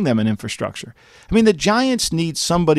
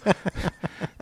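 burst of laughter; 5 semitones higher, 11 dB quieter than usual. Real, not acted. Laughs at own mob joke.

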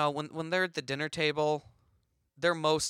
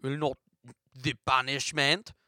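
put-on halting French-accented mime; 2 semitones lower than usual. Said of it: "my French accent isn't that good".

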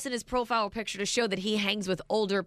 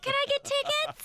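mocking high voice. Mocking the texter's ask.

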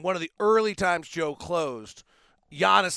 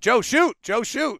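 urging shout; 7 semitones higher, 7 dB louder, slower than usual. Retold or imitated. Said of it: "Is like".

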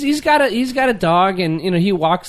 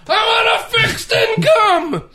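high mock shouting old grump; hammed-up cranky old man with a laugh; grumpy old man yell; slower than usual. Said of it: "And then he goes".

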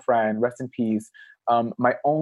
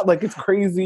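normal talking voice

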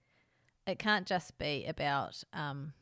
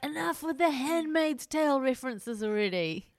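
raised, louder mock exasperation; exasperated retort; 7 semitones higher, 5 dB louder than usual. Man fed up with her Handmaid's Tale references, mock exasperation. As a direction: act out a fed-up soap character snapping.

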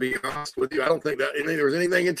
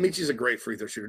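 ordinary delivery

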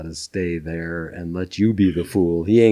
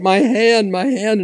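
mock panic; 12 semitones higher than usual. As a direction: fake horror over a lost hand. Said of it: "Prince is like".

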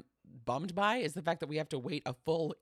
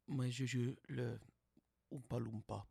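imitating Hugh Grant's French; 4 semitones lower, 7 dB quieter than usual. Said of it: "And he goes".

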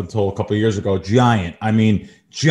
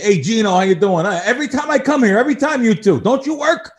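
movie quote; 9 semitones higher than usual. Retold or imitated. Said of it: "going into the Copa and saying".

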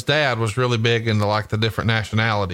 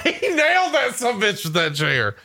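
awed disbelief; 12 semitones higher than usual. Real, not acted. Reliving a chair shot in awe.

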